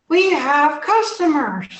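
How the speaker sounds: high, loud creature voice; talking tumor. Acted. Talking tumor alerts the gnome to customers, high creature voice. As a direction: announce it drawn-out in a high-pitched creature squeal. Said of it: "and the tumor says".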